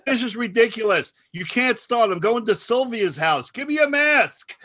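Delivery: high, loud mock exasperation; exasperated complaint. Elderly woman giving up on the Zoom party, exasperated shouting. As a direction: imitate a fed-up old woman yelling. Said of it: "Then the woman goes".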